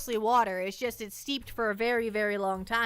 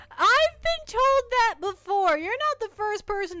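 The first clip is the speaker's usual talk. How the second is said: hammed-up drama; 11 semitones higher, 7 dB louder than usual. Dramatic reply to chat joke.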